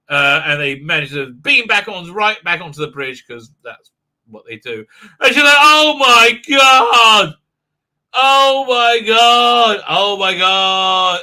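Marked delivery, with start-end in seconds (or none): 5.6-11.2 relieved exclaim. Retold or imitated. Captain's relief.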